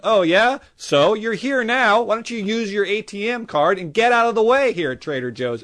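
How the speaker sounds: high, loud, sarcastic rant. Acted. Telling off a Brooklyn guy blocking the way at Trader Joe's, loud sarcastic rant. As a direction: snipe at him, fed up. Ranting at imagined New Yorker.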